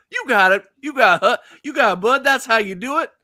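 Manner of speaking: high, loud encouragement; hyped-up coach cheer. Acted. Character cheers on Hux's poor jogging, hyped-up coach. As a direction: cheer him on, rising in pitch.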